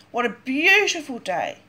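ordinary delivery

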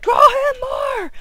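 high, loud, slow fangirl shout; hammed-up, high and loud badass cheer; mock excited sister. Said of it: "She's like".

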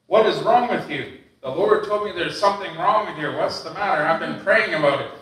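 concerned grandma. Retold or imitated. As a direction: lightly voice grandma pressing with concern. Voices grandma confronting Anne.

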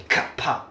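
"カッパ" for car park, cut short; two short syllables, "カッパ", stop between them; crisp Singaporean clip. Shown as a demonstration. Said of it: "もっとキレがある感じ".

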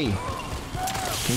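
high, slow exasperated yell; drawn-out yell. Real, not acted. Yells at monster.

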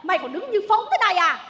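high, agitated scolding; furious goading. Acted. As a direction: egg your son on in outrage. Mother incites son against his wife.

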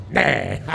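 loud bleated "neeh"; mock dialect cry. Singer mocks northerners speaking dialect, loud bleated cry. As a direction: blurt a drawn-out nasal dialect interjection. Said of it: "i milanesi e i bolognesi ... parlano dialetto".

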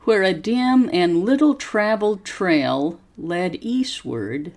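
slow, careful story reading for students to repeat; slow model reading. Shown as a demonstration. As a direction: pause after each phrase and lift the tune clearly. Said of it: "Copy how my voice goes up and down".

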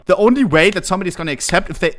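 usual speaking voice

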